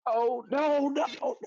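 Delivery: very high, loud acted wail; hammed-up cry of horror; freaking out. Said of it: "he just freaking out".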